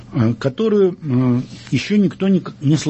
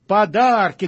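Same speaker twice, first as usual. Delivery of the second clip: higher-pitched, stretched exclamation; booming God; 6 semitones higher, slower than usual. A narrator's reading. God answers the cowering parents, lightly voiced booming. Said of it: "громыхнул Бог".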